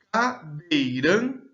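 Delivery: "Cadeirante" slowly in four pulses, CA, DEI, RAN, TE; split into syllables. Shown as a demonstration. Teacher showing four voice emissions, "Cadeirante" slowly syllable by syllable. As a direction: spell out "Cadeirante" by sound, one syllable at a time. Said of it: "Há quatro emissões de voz nessa palavra".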